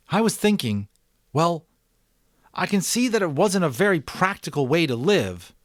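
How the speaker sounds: put-on a boy confessing, tumbling over words; young Jonas. A narrator's reading. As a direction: Jonas telling the Giver about love, high and loud. Jonas voiced in read story.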